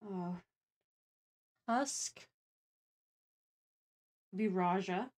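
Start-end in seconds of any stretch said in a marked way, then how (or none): none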